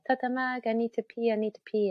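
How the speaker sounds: put-on pleading like a toddler who needs the loo; little boy. Mother voicing her son begging for the toilet, high childish whine.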